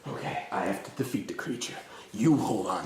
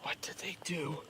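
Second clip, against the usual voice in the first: unvoiced, fearful whisper; 7 semitones higher, 8 dB quieter than usual. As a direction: whisper it in dread. Whispered dread.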